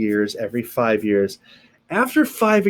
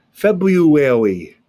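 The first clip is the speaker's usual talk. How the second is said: February stretched slowly, every syllable drawn out; February spoken slowly and deliberately for students; 2 semitones higher, 7 dB louder, much slower than usual. Shown as a demonstration. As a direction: draw February out slowly and carefully. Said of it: "demonstrating the word slowly".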